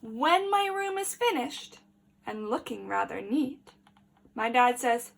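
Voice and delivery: put-on lively read-aloud for small children; story reading. A narrator's reading. Teacher reading a picture book to the class, lively.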